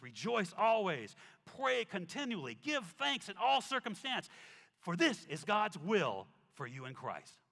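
loud, slow emphatic scripture reading; preaching scripture. Acted. Preacher reads Thessalonians to the church, loud slow proclamation. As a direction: proclaim each line with force.

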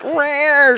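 high, loud mock cat growl.

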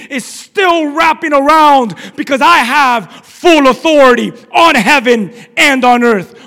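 loud, ringing declarations of authority; full-force preaching. Acted. Preacher voices the Lord on his throne, forceful and commanding. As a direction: preach it forcefully, punching each phrase.

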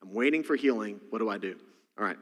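usual speaking voice

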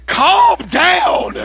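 mock startled coworker. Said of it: "And he was like".

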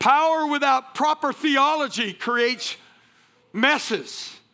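slow, weighty pulpit declaration; slow preacher's proclamation; emphatic preaching. Real, not acted. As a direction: drive each word home to the congregation.

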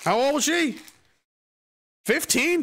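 high, questioning put-on voice; high-voiced fixer. Fixer asking about the victim's age, high put-on voice. Acted fixers discussing a scandal.

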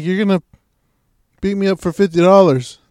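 loud, disbelieving question; incredulous protest. Retold or imitated. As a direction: challenge them in mock disbelief. Victim questions the threatened beating.

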